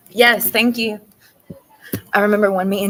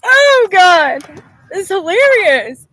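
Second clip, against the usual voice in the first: loud, high laughing cry; crying with laughter; 10 semitones higher, 10 dB louder, much slower than usual. Real, not acted. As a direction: laugh until it hurts. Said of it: "I can't".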